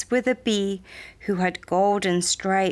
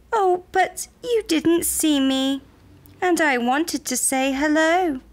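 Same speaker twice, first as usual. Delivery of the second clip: high little frog voice; tiny frog, apologetic; 8 semitones higher than usual. A narrator's reading. Tiny frog explains himself to startled creatures, high and pleading. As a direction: plead in a small, high-pitched voice.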